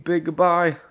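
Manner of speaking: normal talking voice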